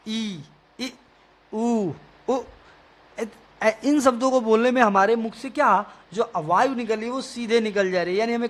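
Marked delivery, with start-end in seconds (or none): 0.1-2.4 ई and इ, उ and उ sounded out clearly; teacher demonstrating vowels; vowel demo. Shown as a demonstration. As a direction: sound out each vowel for the class. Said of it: "देखो अ बोलने में कहीं रुकावट आई?".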